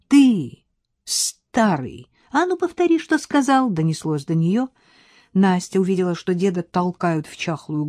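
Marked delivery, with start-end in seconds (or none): 0.1-3.7 high, jeering challenge; thug taunting. Acted. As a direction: pick a fight with the old man. Хулиган наезжает на деда. (задиристо, выше)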